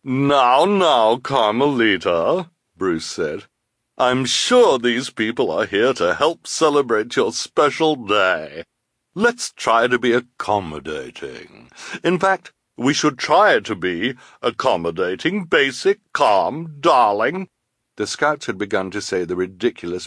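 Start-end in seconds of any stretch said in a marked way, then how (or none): 0.0-2.5 high, loud placating voice; put-on scout leader voice. Voices Bruce soothing the girl. 4.0-17.5 put-on jolly scout leader voice; accommodating leader, building louder and higher; placating scout leader.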